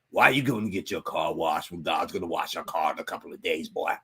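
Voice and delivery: low, scolding uncle voice; uncle impression. Uncle questioning nephew's car wash, low mock scolding. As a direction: drop right down and lecture like an uncle. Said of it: "my uncle used to be like".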